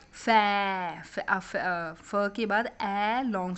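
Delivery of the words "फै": फै with a long, held open vowel; phonic sound. Shown as a demonstration. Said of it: "phonic sound पहले कर लो".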